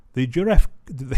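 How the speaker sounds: reading but cracking up mid-word; breaking into laughter. Real, not acted. Vlogger reading the article, cracking up. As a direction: try to read it, then lose it laughing. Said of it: "I can't even say it for laughing".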